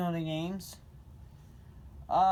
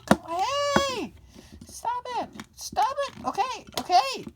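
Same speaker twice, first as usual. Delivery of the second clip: much higher voice, yelling then calming; pretend shouting driver; 3 dB louder than usual. Second pretend driver arguing back in a much higher voice. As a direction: play the frantic other driver, up high.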